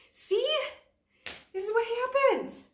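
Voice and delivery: high, loud winded self-mockery; breathless exasperation. Real, not acted. Instructor winded mid-class, high and loud. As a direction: laugh at yourself for running out of air. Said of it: "I get it, lady".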